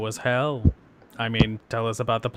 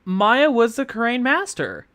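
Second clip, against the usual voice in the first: puzzled character voice; 9 semitones higher, 7 dB louder, slower than usual. Acted. Confused in-character musing.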